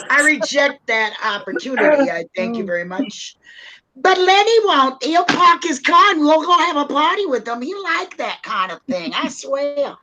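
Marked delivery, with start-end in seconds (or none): 4.0-9.9 high Bronx voice, stretched opening; hammed-up Bronx accent; New York Bronx accent. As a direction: brag in put-on New York. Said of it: "don't you like my New York Bronx accent".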